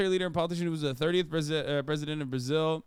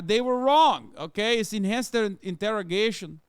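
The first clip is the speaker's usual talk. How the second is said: mock Brazilian accent, higher and louder; hammed-up Brazilian accent playing a dictator's grandson; loud, high Brazilian-accented grandson defending the dictator; 6 semitones higher, 5 dB louder than usual. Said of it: "I'm not very good at Brazilian accents".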